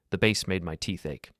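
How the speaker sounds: plain reading voice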